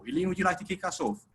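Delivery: ordinary talk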